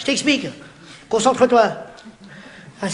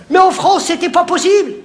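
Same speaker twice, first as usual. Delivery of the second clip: emphatic declaration; 6 semitones higher, 3 dB louder than usual. Acted. Emphatic punchline setup.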